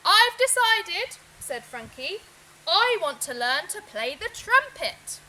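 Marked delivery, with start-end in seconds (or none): 0.1-1.2 big, slow declaration, much higher and louder; loud announcement. Acted. Frankie announcing loudly to his parents. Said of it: "made a very loud announcement". 2.7-5.2 proud, loud declaration; loud announcement. Acted. Frankie telling his parents, loud and proud. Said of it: "made a very loud announcement".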